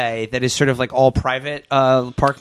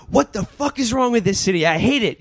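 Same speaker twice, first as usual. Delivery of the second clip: imitating angry man; 7 semitones higher than usual. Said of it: "someone angry".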